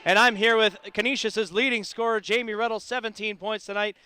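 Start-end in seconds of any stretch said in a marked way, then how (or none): 0.1-2.5 announcer voice. Real, not acted. Opens the postgame broadcast.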